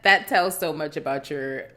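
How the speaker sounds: usual speaking voice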